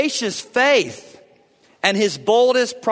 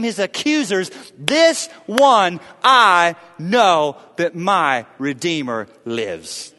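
impassioned preaching; 4 semitones higher, 3 dB louder than usual. Acted. Preacher speaks as suffering Job defying his miseries, impassioned. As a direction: build insistently to a forceful declaration. Voicing Job's list of miseries.

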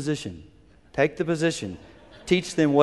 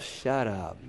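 muttered inner retort; 6 semitones lower, 8 dB quieter than usual. Acted. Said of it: "just think to yourself".

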